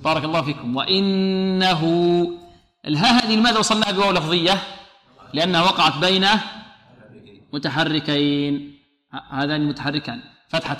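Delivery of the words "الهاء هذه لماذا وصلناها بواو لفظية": ordinary talk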